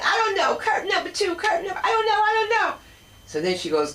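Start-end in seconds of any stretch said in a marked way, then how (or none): 0.0-2.7 high, giggly, dithering woman; flustered contestant. Retold or imitated. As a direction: dither between curtains, laughing. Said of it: "She's like".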